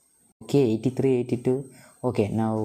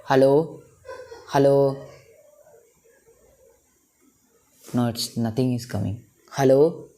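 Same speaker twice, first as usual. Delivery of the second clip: calling out; 3 semitones higher, 4 dB louder than usual. Real, not acted. Calling voice assistant.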